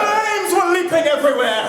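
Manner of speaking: high-pitched theatrical shout; hammed-up cry of disaster; mock alarm. Storyteller describing the burning house to the audience, mock alarm.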